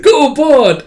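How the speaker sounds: high, loud cheer; loud sports cheer. Retold or imitated. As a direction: shout encouragement to the dog. Cheering on dog.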